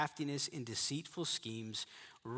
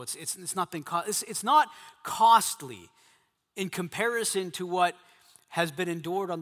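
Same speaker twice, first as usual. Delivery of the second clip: louder and higher, building force; rising preacher intensity; 4 semitones higher, 8 dB louder than usual. Real, not acted. Preacher pressing men on the cost of faith, louder. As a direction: press the point hard.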